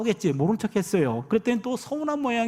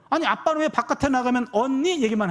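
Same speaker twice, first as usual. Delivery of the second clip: higher-pitched imitation of a child's complaint; child arguing; 5 semitones higher than usual. Second daughter confronts dad about big sister, imitated childish complaint. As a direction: protest like an aggrieved little daughter. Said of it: "이렇게 따지는데".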